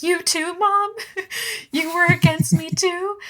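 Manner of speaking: very high, hammed-up squeal breaking into laughter; mock betrayed son. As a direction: whine it up high as the wounded son.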